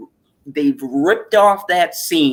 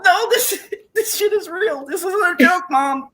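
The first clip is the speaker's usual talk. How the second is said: much higher, laughing through the words; laughing insistence. Retold or imitated. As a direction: insist it's real while cracking up. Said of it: "And I go".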